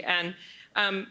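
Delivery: ordinary delivery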